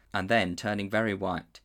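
ordinary delivery

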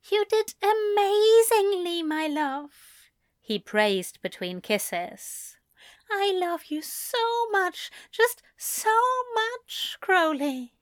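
high, tender angel voice praising; doting angel. A narrator's reading. Loving angel praises Crowley between kisses, high and doting. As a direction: gush with affection between kisses.

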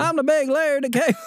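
Larry the Cable Guy. Pretend. Asked by his cohost, doing a quick Larry voice. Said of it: "do your Larry for it".

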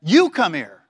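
loud mother's summons, "You" punched; emphatic command led by a strong "You"; stress on YOU. Retold or imitated. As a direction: hit "You" hard, like a mother calling a child over. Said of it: "It's emphasized".

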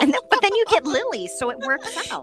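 tipsy, giggling and squealing high; giddy tipsy laughter through the words. Real, not acted.

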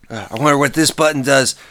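normal talking voice

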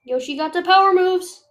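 loud, high hype call; excited shout. Real, not acted. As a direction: shout it out pumped. Hyped moment in game.

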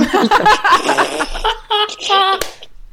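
high, bright burst of laughter. Real, not acted. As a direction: crack up laughing. Laughing at memory.

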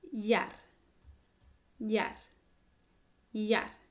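yar with a soft Y sound; soft yeísta Y. Shown as a demonstration. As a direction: yar with a gentle palatal Y, tongue not touching. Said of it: "sale un sonido más suave".

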